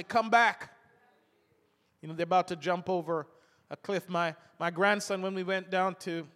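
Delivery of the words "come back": sharp call. Retold or imitated. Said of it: "by the collar and say".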